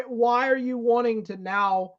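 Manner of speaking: ordinary delivery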